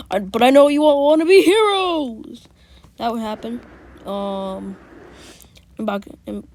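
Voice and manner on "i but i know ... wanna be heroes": loud, higher lecturing teacher voice; teacher addressing the class. Retold or imitated. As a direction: play the teacher talking down to eager kids. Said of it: "the teacher would be like".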